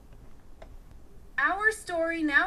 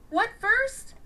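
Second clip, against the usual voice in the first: eager and bright, pitched up; 11 semitones higher, 5 dB louder than usual. A narrator's reading. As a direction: ask it eagerly. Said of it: "eagerly said Tanya".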